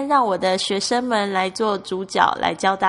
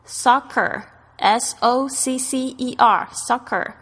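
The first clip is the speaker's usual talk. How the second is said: slow, letter by letter, a bit higher; spelled out; 4 semitones higher, much slower than usual. Shown as a demonstration. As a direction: spell the word slowly for the class. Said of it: "他们是叫soccer".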